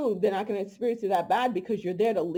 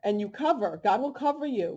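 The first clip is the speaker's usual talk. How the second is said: normal talking voice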